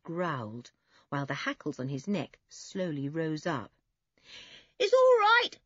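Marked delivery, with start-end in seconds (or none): 4.8-5.6 high, loud shout. A narrator's reading. Toby to the girls outside, shouting. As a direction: shout reassurance across a yard. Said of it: "shouted Toby to the girls".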